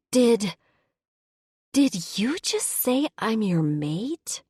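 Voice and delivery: a high, loud stammer easing into a slow question; stunned disbelief. A narrator's reading. Human woman hears the werebear call her his mate, stunned. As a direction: catch your breath, then ask it slowly.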